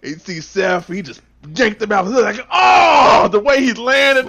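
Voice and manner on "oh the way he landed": excited shout. Real, not acted. Shouting at replay.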